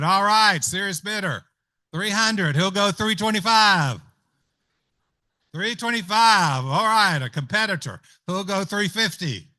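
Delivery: steady auction call, counting up bids; auctioneer calling bids. Acted.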